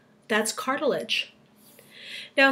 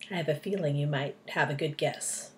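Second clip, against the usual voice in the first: ordinary delivery, nothing marked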